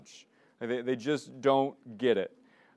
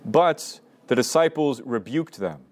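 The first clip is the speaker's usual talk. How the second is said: louder, slow scripture reading with long pauses; slow Gospel reading; 4 dB louder than usual. Shown as a demonstration. Leader reading the Gospel aloud for lectio divina, slow and solemn. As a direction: read each phrase deliberately, letting it sink in. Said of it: "I'm just gonna read it slowly".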